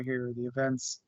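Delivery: usual speaking voice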